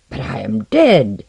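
loud, slow wrathful protest. A narrator's reading. King objecting to the ambassador's plan, wrathful. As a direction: hit the last word hard in anger. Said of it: "Argivan said wrathfully".

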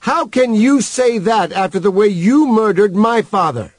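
high, fast, outraged outburst; ringing accusation. A narrator's reading. Wanda accuses Human of her father's murder, outraged. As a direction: ring out high over the last speaker, rushing. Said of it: "Wanda's voice rang out above his".

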